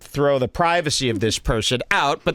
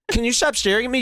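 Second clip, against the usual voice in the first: high-pitched mock confrontation; retold psycho mode; 8 semitones higher, much faster than usual. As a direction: re-enact challenging the staring guard. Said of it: "So then I go into psycho".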